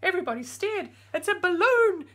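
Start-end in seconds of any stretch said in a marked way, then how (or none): 1.1-2.1 high, loud butcher exclaiming. Acted. Butcher in the crowd exclaiming, high and loud. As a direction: call it out amazed. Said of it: "said the butcher".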